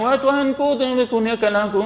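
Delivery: high, offhand mock plea; careless prayer. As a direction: toss the prayer off without hope. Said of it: "harapan tu kira sambil-sambil". (doa sambil lewa yang dibuat-buat)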